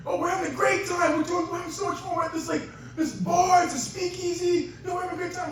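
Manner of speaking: high-pitched, carefree dad on the phone; partying dad. Retold or imitated. Dad calling from a bar in Vegas, high party voice. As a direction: play a dad having a great time, voice way up high.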